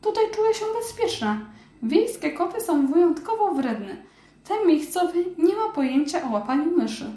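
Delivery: high, amazed mouse voice; a tiny mouse who can't believe it's real; little mouse voice. Acted.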